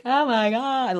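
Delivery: an awed, drawn-in "oh my God"; awestruck. Retold or imitated. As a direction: imitate wide-eyed newcomers gushing. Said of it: "awe, in awe".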